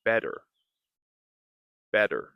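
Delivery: better, D-like flap; flap T demo. Shown as a demonstration. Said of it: "we can say".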